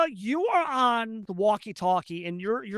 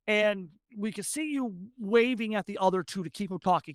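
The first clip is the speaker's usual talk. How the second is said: normal talking voice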